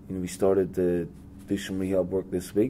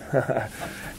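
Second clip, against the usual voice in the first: brief amused chuckle; a quick real laugh at the memory; light laugh, amused by the prank call; short laugh; 5 semitones higher than usual. Real, not acted. Recalls the prank phone call.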